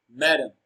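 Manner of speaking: madam with a strong, falling "ma"; stress on MA. Shown as a demonstration. Teacher giving the answer madam, stress on MA. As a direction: lean on "ma" in madam. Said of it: "Ma is pronounced louder and longer".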